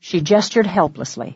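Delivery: plain reading voice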